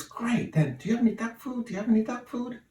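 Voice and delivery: high squawky duck, fast and eager. Acted. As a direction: rush it like a greedy duck. Punchline: duck asks again.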